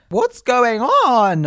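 high, loud, drawn-out mock wail; exasperated disbelief. Model learning of a second show, mock-outraged wail. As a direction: stretch it out slowly in theatrical dismay.

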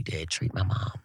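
very soft, whispered mock-heartfelt confession; whispery, put-on sentimental aside; hushed teaser. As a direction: drop to a whisper, playing up the pride. Mock-solemn teaser before break.

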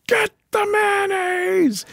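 high, strained yell, drawn out; stretched-out high-pitched mock holler. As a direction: re-enact a drunken party yell without full volume. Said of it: "running around the party yelling".

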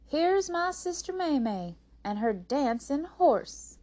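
loud, high, slow show introduction; stage announcement. A narrator's reading. Rose introduces her sister to the people, announcing. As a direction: present the act to a crowd.

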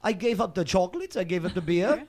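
lightly put-on German accent; German ex-girlfriend. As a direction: imitate a German woman's report. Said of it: "She goes".

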